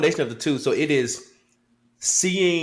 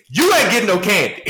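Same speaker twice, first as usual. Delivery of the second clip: put-on raised, higher dad voice cutting the kid off; firm dad refusal; 7 semitones higher, 6 dB louder than usual. A father to his begging child, loud and final. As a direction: lay down the law, laughing at the kid.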